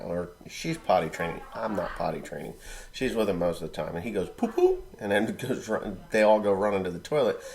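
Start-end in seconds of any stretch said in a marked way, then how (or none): 4.3-4.8 put-on high squeaky toddler call; mock toddler poo poo call. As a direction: chirp it like a little kid. Child's call voiced in story.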